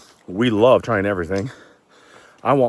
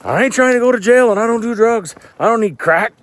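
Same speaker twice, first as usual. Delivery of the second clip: much higher and louder, a mock-panicked outburst; alarmed protest; 13 semitones higher, 6 dB louder than usual. Telling the lady he doesn't do drugs, in high loud mock panic. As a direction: throw up a high, loud, shocked denial. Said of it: "I go".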